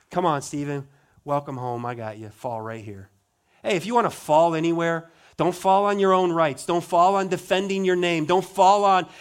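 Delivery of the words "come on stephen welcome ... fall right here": gentle welcome. Acted. Jesus welcoming Stephen home.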